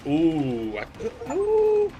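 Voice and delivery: sudden high squeal of surprise, loud on the last ooh; startled squeal. Real, not acted. Streamer startled as the killer nears, high squeal. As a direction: jump up high and loud at the scare.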